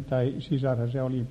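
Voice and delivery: ordinary talk, nothing marked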